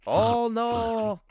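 high, wailing mock French accent; hammed-up French wail. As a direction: lament theatrically in a fake French accent. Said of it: "my stereotypical accent that is horrible".

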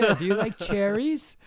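high, loud, laughing; hammed-up sweet doctor voice; mock doctor. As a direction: coax like a creepy doctor. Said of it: "And he said".

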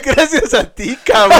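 high laughing thanks; laughing sarcastically. Retold or imitated. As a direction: laugh it out, mock grateful. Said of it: "Y yo".

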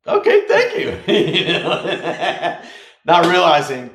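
giggling, high-pitched, flustered thanks; laughing. Retold or imitated. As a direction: squeak it out, laughing at yourself. Retelling awkward gift acceptance.

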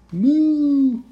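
long, high moo; cow moo. Acted. Storyteller voicing the moo hitched back onto cow, long high moo.

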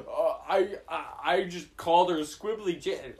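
sheepish excuse. Retold or imitated. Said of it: "I'm like".

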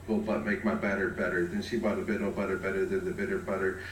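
fast, even recitation of a tongue twister; rapid tongue twister. Shown as a demonstration. Reciting a tongue twister fast to open the show. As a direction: rattle it off fast.

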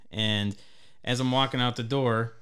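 ordinary delivery

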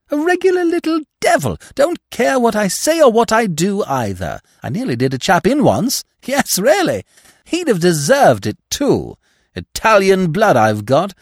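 a hint of a cheeky little devil; lively girl boasting.

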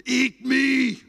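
high, loud shout of a hungry loaf; bread shouting. Pretend. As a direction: let the bread yell at you. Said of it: "bread is shouting at you".

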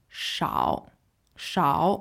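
slow, drawn-out 少 in a dipping third tone; careful pronunciation. Shown as a demonstration. Teacher repeating 少 for learners, slow and careful. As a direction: sound out 少 slowly, starting on a clear SH. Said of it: "Let's say it one more time".

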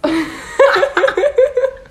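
sehr hohes, lautes Lachen; schallendes Lachen. Real, not acted. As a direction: sich kaputtlachen. Lacht über Zoe im Bad. (sehr hohes, schallendes Gelächter)

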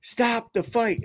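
high, slow yelled plea; mock yell to stop the fight; imitating a yell. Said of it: "Paul Felder was yelling".

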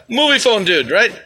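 normal talking voice

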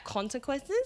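"consequences" coming out a little weirdly; oddly said word. Real, not acted. Host on always thinking ahead, "consequences" said weirdly with something in the mouth. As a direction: stumble oddly over the sound of "consequences". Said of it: "I said that really weirdly".

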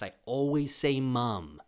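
loud, slow stress on "say mom"; emphatic insistence. Real, not acted. Podcaster insisting on the family word, emphatic.

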